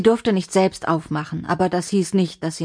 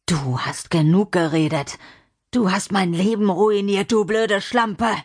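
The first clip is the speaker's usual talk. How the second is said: angry accusation; 2 semitones higher than usual. Acted. John blaming Lina for his ruined life, enraged.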